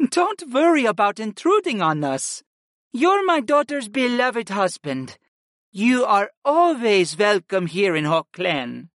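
put-on gently reassuring older woman; reassuring mother voice. Retreya reassures son-in-law.

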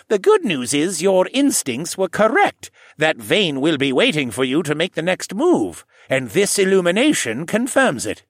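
put-on confident old koala reassuring; old man voice. A narrator's reading. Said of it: "he concluded".

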